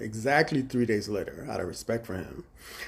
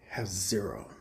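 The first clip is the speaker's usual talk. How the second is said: whispered; 6 semitones lower, 10 dB quieter than usual. Retold or imitated. Manager stresses others' zero.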